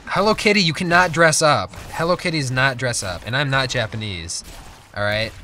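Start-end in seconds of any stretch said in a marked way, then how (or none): 0.1-3.2 louder, higher mock protest; mock indignant. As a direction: fire back in playful indignation. Indignant retort to teasing.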